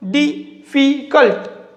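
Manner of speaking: difficult drawn out slowly, syllable by syllable; teacher slowing down to show difficult has three syllables. Shown as a demonstration. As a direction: stretch difficult so each of its three syllables is heard. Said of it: "three syllable".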